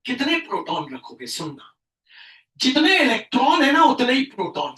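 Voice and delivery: ordinary delivery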